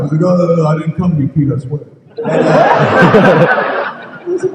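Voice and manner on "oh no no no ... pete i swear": fast, loud, flustered excuses; flustered denial. Retold or imitated. Woken actor pleads with the director, fast and flustered. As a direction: blurt it out half awake.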